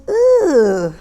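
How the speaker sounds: loud, high "ugh" of disgust; long shuddering groan as the creeped-out dog. Retold or imitated. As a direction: recoil aloud from a creepy touch. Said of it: "And the dog goes".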